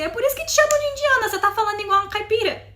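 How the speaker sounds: high, loud, rapid-fire teasing; teasing outburst. Retold or imitated. Friend teasing the learner about her accent, loud mock exasperation. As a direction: rattle it off as a mock scolding.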